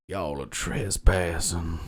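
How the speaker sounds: slow lazy Southern drawl imitating the rat; a light Southern accent, drawn out slowly. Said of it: "He's Southern".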